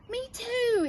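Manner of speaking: high excited agreement; eager exclamation. Acted. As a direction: jump in eagerly. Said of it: "exclaimed the knight".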